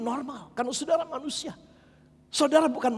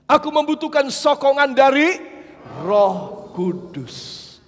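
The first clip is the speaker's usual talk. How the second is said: drawn-out plea; 3 semitones higher, 5 dB louder, slower than usual. Acted. Prayerful quote, drawn out.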